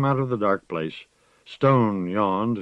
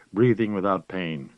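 ordinary delivery, nothing marked

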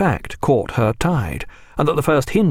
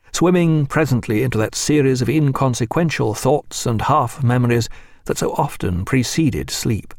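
plain reading voice

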